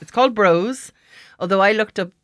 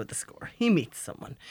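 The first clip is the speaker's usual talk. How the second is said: whisper; 2 semitones lower, 8 dB quieter than usual. Acted. Radio host teasing movie plot.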